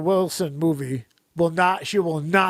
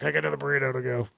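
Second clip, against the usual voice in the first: rushed mumble; 4 semitones lower, 5 dB quieter, much faster than usual. Retold or imitated. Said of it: "a blooper of just me being like".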